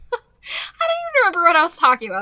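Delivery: quick laugh through the words. Real, not acted. Losing her train of thought after the cat, laughing quickly. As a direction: laugh it off, flustered.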